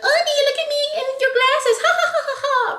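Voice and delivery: high, sing-song voice dissolving into giggles; eerie sweet laugh. Retold or imitated. Heroine coos at the little girl on the plane, then laughs high. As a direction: coo at a child then cackle.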